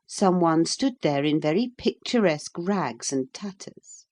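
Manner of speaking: usual speaking voice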